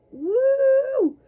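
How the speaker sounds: a sudden loud high whoop through the burn. Real, not acted. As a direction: let out a high, loud woo. Burning calf under the roller.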